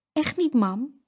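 put-on high little-girl insistence; insisting child. A narrator's reading. Saskia vouches for Jeroen to mama, high insistent voice. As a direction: back up your brother earnestly. Saskia backs up her brother.

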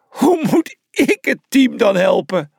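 high-pitched forlorn plea; sad sniffling. A narrator's reading. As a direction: plead in a high, sorry voice. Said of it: "snufte Brutus verdrietig".

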